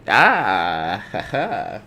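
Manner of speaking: drawn-out "ah, ha, ha" fake laugh; mock laugh. Host cueing co-host after his dad joke, showy fake laugh. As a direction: laugh showily at your own joke.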